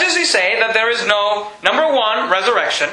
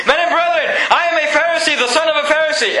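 yelling the claim; 5 semitones higher than usual. Retold or imitated. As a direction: cry it out to the whole council. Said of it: "he begins to yell this".

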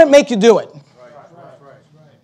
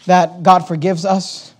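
ordinary talk

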